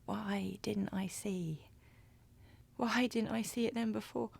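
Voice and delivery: soft, near-whispered questioning; hushed self-reproach. Acted. Woman asking herself why she didn't see it, hushed self-reproach. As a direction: ask it under your breath, to yourself. Realising the partner was abusive.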